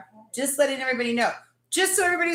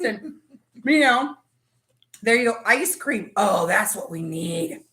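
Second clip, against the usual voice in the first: ordinary talk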